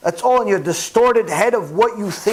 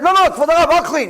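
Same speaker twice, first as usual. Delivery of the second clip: raised, eager offer to take over; eager insistence; 8 semitones higher, 4 dB louder, faster than usual. Retold or imitated. Vegetable man eagerly offers the rabbi help with cleaning. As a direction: jump in to do the work.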